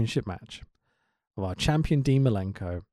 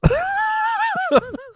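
high shrieking burst of laughter; 6 dB louder than usual. Real, not acted. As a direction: crack up loud after the blunder. Embarrassed blunder, big laugh.

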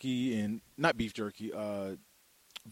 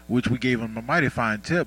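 ordinary delivery

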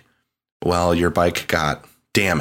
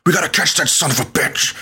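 mock Oregon biker; 10 semitones higher than usual. Podcaster mocking Deacon's dialogue in a put-on biker accent. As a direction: sell the cheesy line like a bad game actor. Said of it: "Deacon's like".

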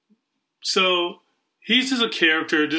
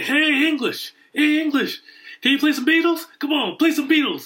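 high-pitched mock call to a band; imitated heckling; 10 semitones higher than usual. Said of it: "as he's heckling".

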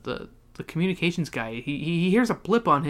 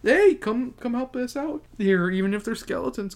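calling out; 8 semitones higher than usual. Retold or imitated. Acted calling people over.

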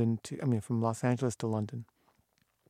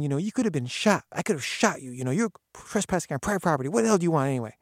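raised, louder telling-off; angry scolding; 5 semitones higher, 5 dB louder than usual. Retold or imitated. Gardener scolding trespassers, angry and loud. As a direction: warn them they could be shot.

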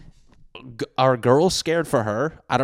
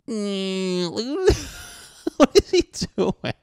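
high squeaky laugh through the words; 11 semitones higher, slower than usual. Real, not acted. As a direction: crack up mid-question. Laughing at the clip.

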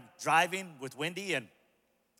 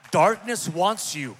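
loud, slow, building sermon climax; full-force preaching; 4 semitones higher, 11 dB louder than usual. Acted. Pastor to the church, loud and slow preaching. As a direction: drive it home to the crowd.

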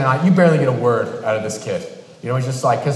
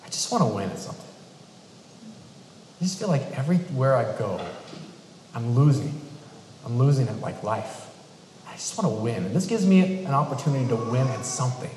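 serious and soft; 4 semitones lower, 7 dB quieter, faster than usual. Retold or imitated. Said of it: "he gets real serious".

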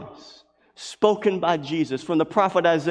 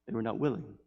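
much softer, sinking on willing; soft echo; 5 semitones lower, 12 dB quieter, faster than usual. Acted. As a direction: let it fall away sadly. Soft echoed repetition.

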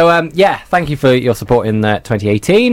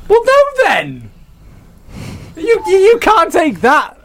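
indignant. Real, not acted. Mock outrage at guest.

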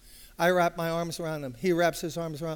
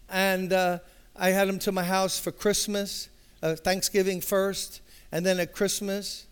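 louder, driving preacher delivery; full-force preaching; 3 semitones higher, 6 dB louder than usual. Acted. Preacher telling the congregation about David, full force. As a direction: preach it out to the room.

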